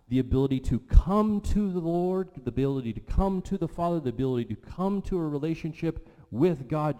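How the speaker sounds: impassioned pulpit build; emphatic preaching. Real, not acted. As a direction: drive each repeated phrase home, high and forceful.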